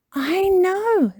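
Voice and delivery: put-on high, loud know-it-all child; Peppa Pig. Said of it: "says Peppa".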